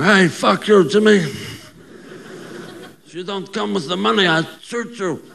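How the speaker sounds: hammed-up Italian mobster threat; movie gangster. Comic plays a film gangster menacing Jimmy, as a mock tough guy. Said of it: "they become gangsters".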